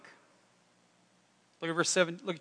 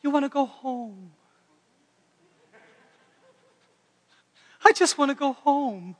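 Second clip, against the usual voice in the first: put-on higher, childlike pleading; mock plaintive; 7 semitones higher, 3 dB louder than usual. Comedian's routine on baseball going home, mock plaintive. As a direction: parody a wistful player longing for home. Performs contrasting gentle baseball side.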